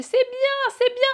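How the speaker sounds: very high, sing-song encouragement; encouraging midwife. Retold or imitated. Midwife encouraging during the push, very high coo.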